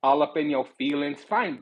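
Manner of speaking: ordinary talk, nothing marked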